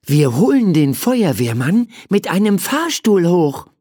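put-on high-pitched child voice; child with idea. A narrator's reading. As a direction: announce it in a high voice. Child voiced proposing a plan.

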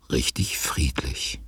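slow whisper, savouring the calm; peaceful whisper. A narrator's reading. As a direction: whisper it as if not to wake anyone. Narrator describes quiet house. (ruhig geflüstert)

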